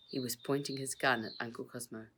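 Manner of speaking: usual speaking voice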